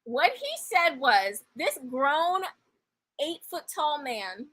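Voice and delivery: normal talking voice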